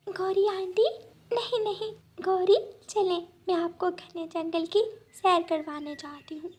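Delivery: eager to please her auntie; little girl bird. Acted. Little bird corrects herself.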